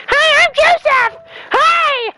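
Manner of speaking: very high, loud, slow squeaky voice; silly high voice. Pretend. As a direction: squeak like a cartoon character. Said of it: "my other friend on the mic called Joseph".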